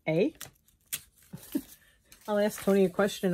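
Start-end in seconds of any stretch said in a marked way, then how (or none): 0.1-0.3 Canadian eh. Retold or imitated. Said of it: "I talk like I'm Canadian".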